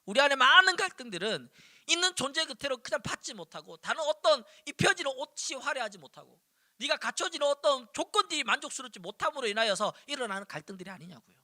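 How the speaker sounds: usual speaking voice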